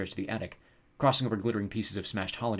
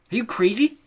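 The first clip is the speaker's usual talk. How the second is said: high, loud disbelieving question; exasperated shout; hollered; 13 semitones higher, 10 dB louder than usual. A narrator's reading. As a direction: holler at a sibling. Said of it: "Mallory hollered at her brother".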